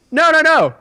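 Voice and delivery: high, loud mock-indignant correction; retold huffy comeback, high and loud; indignant retort. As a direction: correct them with exaggerated outrage. Retelling argument at drive-thru window.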